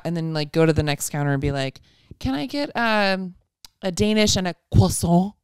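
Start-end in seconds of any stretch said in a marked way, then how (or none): 2.2-5.3 slips into a French croissant; mock French ordering. Mom ordering at the bakery counter, a sudden mock French accent. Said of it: "you are so French".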